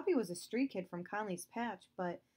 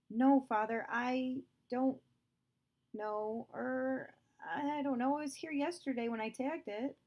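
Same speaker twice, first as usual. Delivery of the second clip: put-on higher, hesitant, faltering boy's voice; nervous boy; 6 semitones higher, 4 dB louder than usual. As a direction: stumble nervously under father's questioning. Justin nervously answers stern father.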